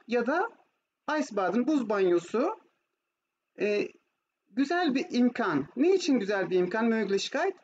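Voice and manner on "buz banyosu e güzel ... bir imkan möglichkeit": normal talking voice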